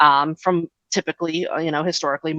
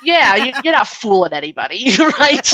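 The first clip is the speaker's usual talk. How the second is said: higher, louder laughing jab; laughing scoff; 6 semitones higher, 4 dB louder than usual. Real, not acted. Host scoffing at queerbaiting through laughter. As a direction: laugh off the coy hedging.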